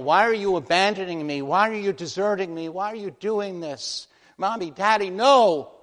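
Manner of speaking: high, loud, pleading cry rising to a scream; child's terrified wail. Acted. A child begging its parents from the electric chair, terrified wail. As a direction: beg desperately, breaking into a shriek on no.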